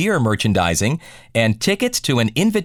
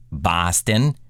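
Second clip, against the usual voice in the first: Boston accent; 7 semitones lower than usual. Shown as a demonstration. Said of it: "it would go something like this".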